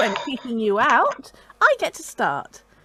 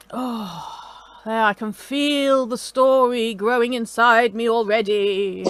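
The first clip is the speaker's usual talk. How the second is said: lightly done hushed storyteller; slower than usual. Acted. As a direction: ease into a mock-spooky tale. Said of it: "It's my storyteller voice".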